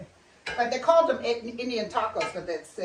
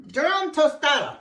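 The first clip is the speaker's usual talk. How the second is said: louder, higher proud call; proud announcement; 5 semitones higher, 7 dB louder than usual. Real, not acted. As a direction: show off the dish. Presenting the dish again.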